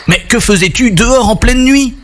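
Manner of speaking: put-on high-pitched, puzzled boy's question; intrigued question. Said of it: "demanda Tristan intrigué". (question intriguée, voix plus aiguë)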